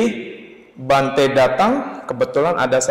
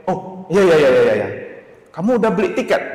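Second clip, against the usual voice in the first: voicing the monk; 5 semitones higher, 5 dB louder, faster than usual. Monk finally answers.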